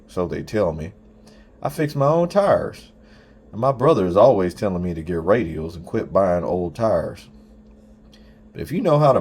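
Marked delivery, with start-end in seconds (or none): none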